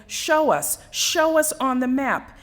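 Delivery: a teacher insisting, show us; teacher pressing the girl in front of the class, insistent and loud. Retold or imitated.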